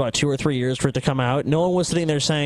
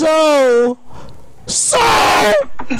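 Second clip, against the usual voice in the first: yelling, much higher and louder; real outraged shout; 12 semitones higher, 6 dB louder, much slower than usual. Real, not acted. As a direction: shout it out in disbelief. Outraged at caller's "so.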